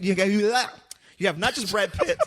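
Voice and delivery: slow, lightly voiced Elmer Fudd lisp; a hint of a cartoon hunter, slowed down; Elmer Fudd accent. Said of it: "What accent is that?".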